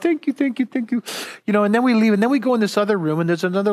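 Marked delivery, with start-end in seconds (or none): 0.0-1.0 1 gushing thanks. Retold or imitated. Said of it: "you know, like".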